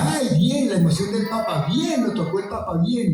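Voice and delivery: high, gushing praise; light mock of gushing people; people congratulating. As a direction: gush like admiring neighbours. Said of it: "la gente me decía".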